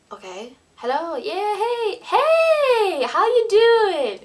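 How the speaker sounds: squeaky high over-eager hello; nervous greeting. Retold or imitated. Nervously answering the famous choreographer's call, squealing high and loud. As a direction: gush a nervous high-pitched greeting to the caller.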